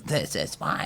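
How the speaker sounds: low, soft know-it-all voice; smug pundit. Retold or imitated. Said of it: "someone comes out and goes".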